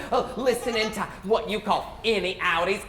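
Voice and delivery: ordinary delivery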